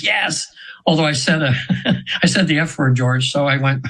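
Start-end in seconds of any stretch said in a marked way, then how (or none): 0.0-0.5 higher-pitched but held-back mock scream; half-hearted re-enacted scream; retold gut scream. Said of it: "deep in my gut, I screamed".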